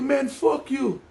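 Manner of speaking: high mock Jamaican-accented curse; Caribbean accent. Cab driver cursing at the kids, high mock Jamaican accent. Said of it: "I don't know if it was a Jamaican accent".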